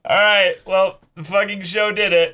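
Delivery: loud, high, fed-up groan at the show; exasperated outburst. Real, not acted. Cohost venting loudly at Clannad's cruelty, exasperated. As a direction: throw up your hands and vent.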